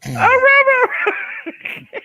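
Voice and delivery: high squealing yap, loud; yappy chihuahua voice. Acted. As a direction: yip like a tiny dog again. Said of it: "Can you do that again?".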